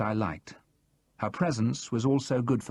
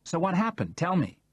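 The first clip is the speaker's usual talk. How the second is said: eager curiosity; 8 semitones higher than usual. A narrator's reading. Charles drops his manner, eager.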